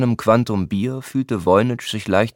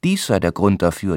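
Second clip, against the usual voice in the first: plain reading voice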